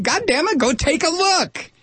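high, slowed exasperated demand; exasperated outburst. Real, not acted. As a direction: hammer it home, fed up.

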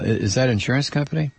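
ordinary delivery

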